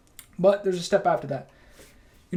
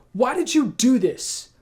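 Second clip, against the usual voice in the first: higher, louder, slow accusing question; mock accusing; 8 semitones higher, 6 dB louder, slower than usual. Someone with a problem confronting you, mock accusing. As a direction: throw the blame like a hurt friend. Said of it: "they're not asking for".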